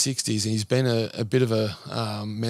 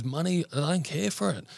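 welling up; 6 semitones higher than usual. Retold or imitated. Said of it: "he starts welling up".

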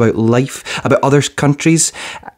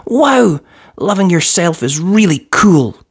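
put-on high, bright-eyed girl's voice, pitched well up; a child thrilled by her discovery; excited little girl; 7 semitones higher than usual. A narrator's reading. Hallie tells her mum self-love is cool, high excited girl voice. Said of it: "she would say".

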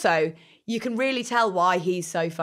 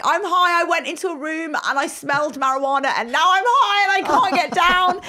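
high, louder, babbling panic; panicked stoned kid; 8 semitones higher, 4 dB louder than usual. Retold or imitated. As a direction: gush it out like a frightened teen. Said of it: "And I was like".

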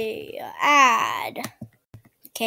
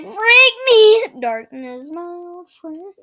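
mock yell; 5 semitones higher, 8 dB louder, slower than usual. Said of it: "He's like".

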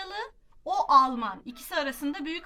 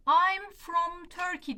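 slow model answer; 5 semitones higher, 6 dB louder, much slower than usual. Shown as a demonstration.

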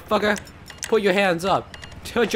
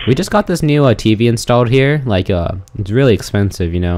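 deep, lowered shopkeeper voice; low owner voice; 11 semitones lower than usual. Acted. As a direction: play the nervous owner low. Acting as shop owner.

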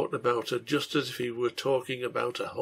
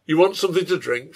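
brisk, louder rude offer; 3 semitones higher, 9 dB louder, faster than usual. A narrator's reading. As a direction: toss the question out quickly and rudely. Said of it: "in a profane and rude manner".

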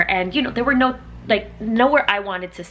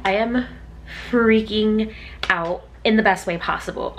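normal talking voice

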